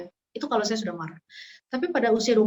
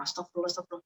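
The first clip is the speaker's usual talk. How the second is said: softer and softer prayer to calm down; a quiet astagfirullah that fades away; murmured istighfar; 4 semitones lower, 11 dB quieter, much faster than usual. Retold or imitated. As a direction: pray yourself calm, fading to almost nothing. Calming herself while angry.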